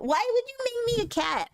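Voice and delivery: put-on childish high whine; high whiny voice. Repeats line, first high.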